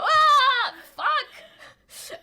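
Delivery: high, very loud drawn-out scream; acted scream of alarm; startled yell. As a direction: yelp out a curse in alarm. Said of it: "like, another guy go".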